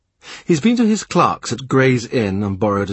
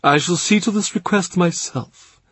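higher voice, feigned solemnity; mock-grave Cardinal; 9 semitones higher than usual. A narrator's reading. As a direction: promise it with pretend gravity. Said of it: "he says gravely".

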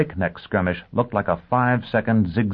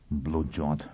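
muttered; 5 semitones lower, 9 dB quieter than usual. A narrator's reading. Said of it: "he muttered".

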